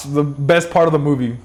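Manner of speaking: normal talking voice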